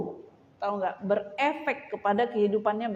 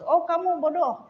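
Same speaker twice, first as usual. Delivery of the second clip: taunting friend; 8 semitones higher than usual. Retold or imitated. Voicing a hurtful friend.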